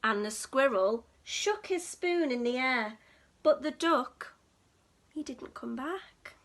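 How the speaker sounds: plain reading voice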